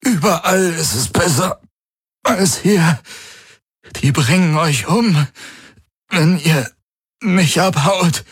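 high, strained, halting warning; hoarse, desperate urging with gasps; croaking. A narrator's reading. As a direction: croak it out phrase by phrase. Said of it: "krächzte Garland".

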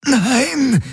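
very high, desperate strangled cry; croaking. A narrator's reading. Galen fights the hand at his throat, croaking. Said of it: "krächzte er".

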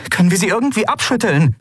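high, fast question; urgent pressing. Acted. Endriel pressing Aylan for escape, fast and high. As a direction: rush the question out. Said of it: "drängte sie Aylan".

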